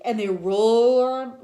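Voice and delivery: slow, loud roar. A narrator's reading. Teacher reading the monsters roaring, loud. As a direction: let it rumble out.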